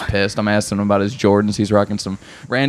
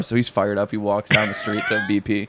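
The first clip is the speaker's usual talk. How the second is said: usual speaking voice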